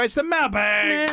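high, slow sung-out announcement; segment jingle call. Acted. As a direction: sing out the segment name like a jingle.

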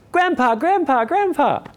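put-on high childlike calling voice; a little grandson pleading for attention; child calling grandpa. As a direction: call out like a small boy after his grandpa. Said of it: "he goes".

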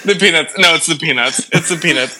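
usual speaking voice

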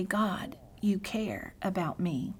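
hushed slow personal prayer; soft prayer. Shown as a demonstration. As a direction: pray it quietly to God, slowly. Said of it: "Change it to a prayer and say".

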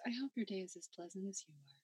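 soft, fast, sweetly polite insult; fast, quiet, sugar-coated customer-service jab; calm rudeness. Shown as a demonstration. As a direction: smile through a rude line, quick and soft. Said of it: "being incredibly rude in a calm voice".

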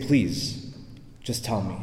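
low, soft coaxing; gentle plea. Retold or imitated. Priest coaxing a dying man to talk, gently. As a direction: ask softly, leaving a pause after please.

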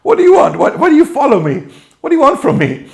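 exasperated mock Jesus. Preacher hamming up Jesus confronting his followers, exasperated. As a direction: throw up your hands, comically exasperated. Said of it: "He's like".

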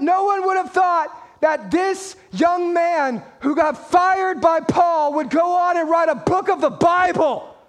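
usual speaking voice

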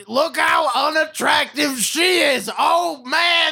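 high mock shout, leering at her looks; mocking the movie. Mocking the movie's message to his friends, high hammed-up shout. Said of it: "it's like".

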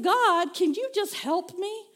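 retold outburst of frustration; frustrated plea. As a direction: beg for help, fed up with yourself. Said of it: "I'm frustrated".

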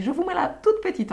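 a quick mock African accent on one line; attempted African accent. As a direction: quote the anaesthetist in a sing-song African accent she admits she can't do. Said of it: "je sais pas faire l'accent, euh, africain".

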